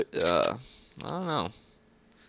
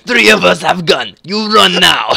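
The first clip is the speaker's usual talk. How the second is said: loud, slow, hammed-up Russian threat; bad Russian accent; 7 semitones higher, 12 dB louder, slower than usual. Mock Russian mafia player threatening the others, bad loud Russian accent. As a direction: boom it out in a fake Russian accent. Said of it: "very bad attempt at a Russian accent".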